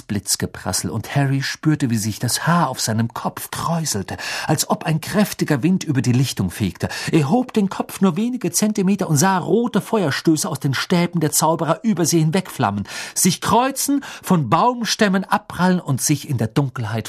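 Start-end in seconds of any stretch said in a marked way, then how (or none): none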